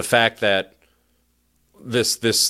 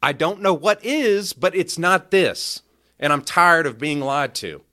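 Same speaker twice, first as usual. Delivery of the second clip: model defiant reply; 6 semitones higher than usual. Pretend. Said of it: "Take a step back and say".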